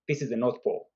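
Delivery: ordinary delivery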